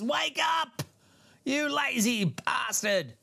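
high-pitched comic wake-up holler; mock yell. Tech pretends to yell filter capacitors awake, high mock yell. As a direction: holler it high, stretching out the insult. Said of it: "yell in their ears".

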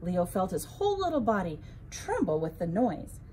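ordinary delivery, nothing marked

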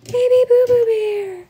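put-on very high squeaky baby talk. Owner cooing very high baby talk to her pet.

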